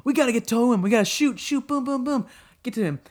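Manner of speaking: hammed-up battlefield urgency; frantic soldiers. Voicing the gang rushing to a downed ally, mock frantic. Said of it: "they're like".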